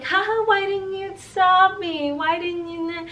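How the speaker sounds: high, laughing mock protest, slowed; joking laugh. Joking with the coworker to hide embarrassment, high laughing tease. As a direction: laugh it off with a teasing whine. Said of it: "I was joking around with him".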